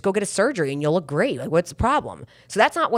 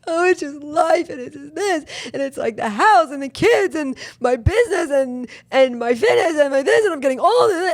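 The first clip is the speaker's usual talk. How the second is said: higher and louder, words spilling out in a rush of stress; overwhelmed tearful venting; 8 semitones higher, 4 dB louder than usual. Retold or imitated. Venting to her mom on the phone, overwhelmed and tearful. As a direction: pour it all out like you're close to tears. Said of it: "And I just started crying".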